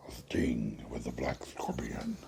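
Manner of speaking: slow deep villain whisper. Shown as a demonstration. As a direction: breathe it like a sinister masked villain. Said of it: "I did that voice".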